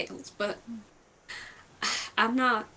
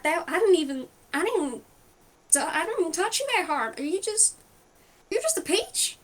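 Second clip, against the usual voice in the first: baffled; 6 semitones higher than usual. Acted. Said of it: "she's just staring like".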